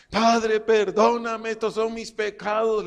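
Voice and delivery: high, loud, drawn-out plea to God; desperate prayer. Retold or imitated. Preacher re-enacting his night prayer to the Father, desperate plea. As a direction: re-enact crying out for forgiveness.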